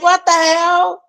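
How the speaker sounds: high, slow, put-on disbelief; imitating her own shock at the rain; mock disbelief. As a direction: stretch the words out in fake shock. Retold reaction to sudden rain.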